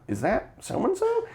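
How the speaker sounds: high, slow, fake-startled question; mock surprise. Teacher spots the principal sprinting toward them, mock surprise. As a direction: pitch way up in mock alarm. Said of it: "she's like".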